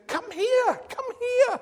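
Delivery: put-on high, insistent old woman's summons; stern little old lady calling; firm old lady. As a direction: beckon him firmly, high and old. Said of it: "fixed her eye firmly on me and said".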